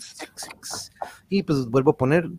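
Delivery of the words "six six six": whisper. Pretend. Whispered devil number.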